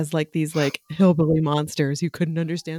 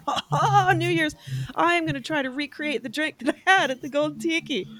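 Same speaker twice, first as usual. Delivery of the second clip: bright, high, gleeful planning voice; excited; 10 semitones higher than usual. Retold or imitated. Telling friends her giddy New Year's plan, pitched high.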